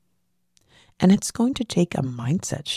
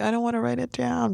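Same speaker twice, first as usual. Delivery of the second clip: imitated reluctance, pitched well up; reluctant protest; 8 semitones higher than usual. Speaker mimics a listener resisting writing the dream down, reluctant. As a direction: play someone balking at a task, voice raised high.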